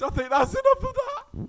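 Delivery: high amused protest; laughing protest. Real, not acted. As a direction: wrap up while still laughing. Still laughing, ending topic.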